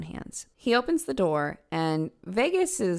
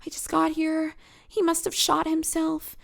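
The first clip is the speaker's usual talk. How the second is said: higher, fake-distraught innocent voice; feigned innocence; 9 semitones higher than usual. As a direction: play the shocked innocent, pitched up. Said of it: "Vegas is off to the side saying".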